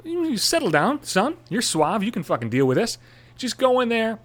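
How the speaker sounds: high mock pep talk to himself; lightly voiced inner coach; self pep talk. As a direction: coach yourself, hammed-up. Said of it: "I'm thinking to myself, like".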